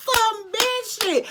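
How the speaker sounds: very high, slow squeal of disbelief; squealing outrage; shrieked repeat. Real, not acted. Repeating punchline, even higher.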